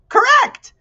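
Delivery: very high, loud cheer; delighted shout. A narrator's reading. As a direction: burst out with the answer. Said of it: "shouted the letterman".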